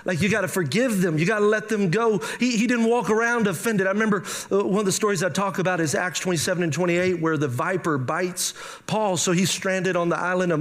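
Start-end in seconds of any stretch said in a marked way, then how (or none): none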